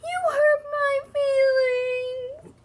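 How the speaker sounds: high, slow, hammed-up wail. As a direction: wail it out like a crushed hippo. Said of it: "she would wail".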